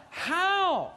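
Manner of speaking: "How?" lifted high and held; emphasized "How". Real, not acted. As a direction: ring out "How?" as a question. Repeating the key word.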